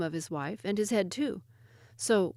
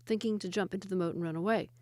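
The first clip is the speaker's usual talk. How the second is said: ordinary delivery, nothing marked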